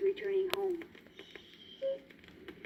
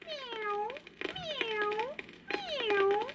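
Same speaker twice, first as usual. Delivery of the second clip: high, soft mewing; kitten mews; 9 semitones higher, 7 dB quieter, much slower than usual. Acted. Kittens crying for lost mittens, soft mews. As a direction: mew like sad little kittens.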